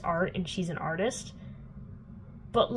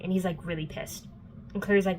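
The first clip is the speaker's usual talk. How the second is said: normal talking voice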